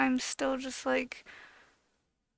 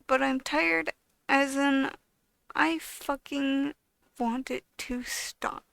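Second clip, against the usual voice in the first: normal talking voice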